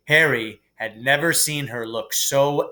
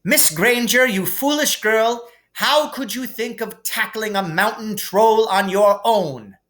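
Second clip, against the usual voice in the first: high, loud, scolding teacher; stern rebuke; 9 semitones higher, 3 dB louder than usual. A narrator's reading. McGonagall scolds Hermione for tackling the troll, angry. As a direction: scold a clever girl for foolishness.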